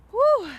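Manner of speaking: shouted woo, high and loud; loud high woo cheer. Real, not acted. Cheering mid set.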